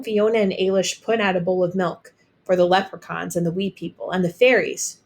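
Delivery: ordinary delivery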